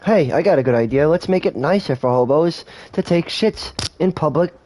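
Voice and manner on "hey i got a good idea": ordinary delivery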